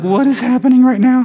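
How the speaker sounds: high-pitched, freaked-out mimicry; mock freaked-out. Disciples react to the townspeople arriving, mock freaked-out. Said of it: "they're, like, freaking out".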